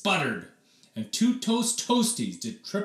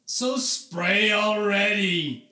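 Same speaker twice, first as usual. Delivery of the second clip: sputtering; 7 semitones higher, 4 dB louder, slower than usual. A narrator's reading. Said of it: "sputtered Oliver".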